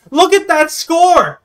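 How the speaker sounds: very high, loud, slow exclamation; thrilled. Real, not acted. Host shows the guest his score, thrilled.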